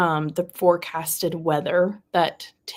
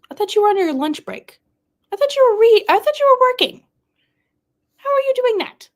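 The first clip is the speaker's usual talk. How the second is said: high, loud, rising disbelief; incredulous; 8 dB louder, faster than usual. Real, not acted. Streamer catching a viewer reading at work, incredulous. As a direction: question them in high-pitched, loud astonishment.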